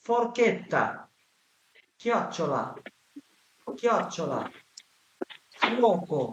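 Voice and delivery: slow, clear Italian model words; each word said like a teacher's model; careful Italian word drill. Shown as a demonstration. Teacher drilling Italian words for Bengali learners, clearly. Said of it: "[name] আপুর উচ্চারণ কিন্তু অনেক ভালো".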